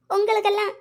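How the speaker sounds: usual speaking voice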